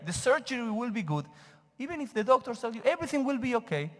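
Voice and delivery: normal talking voice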